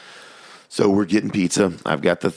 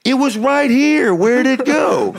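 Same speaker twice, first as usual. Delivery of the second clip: very high, loud, slow comic wail; hammed-up bawling, as if to a back row; Jerry Lewis; 6 dB louder, slower than usual. Son wandering off upstairs still performing, mock wailing. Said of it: "hear him going up the stairs".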